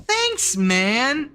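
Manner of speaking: high-pitched acted thanks; touched, grateful. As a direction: gush it high, as if truly flattered. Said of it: "took it literally".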